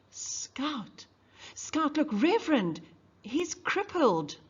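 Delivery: put-on a kid tugging at your sleeve; urgent child. Child urgently noticing Tom's arm.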